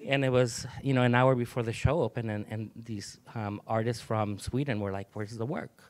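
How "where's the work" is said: light imitation of a Swedish accent. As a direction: quietly mimic a Swedish visitor's accent. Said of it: "in a Swedish accent".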